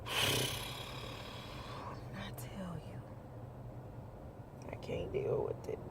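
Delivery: voiceless, exhausted whisper. Real, not acted. Driver at her limit over rude riders, whispering. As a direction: let it drop to a tired whisper.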